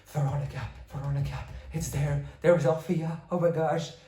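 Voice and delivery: retold thrill at first sight of the towers; excited calling out. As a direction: eagerly point out the towers to a friend. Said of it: "I was like".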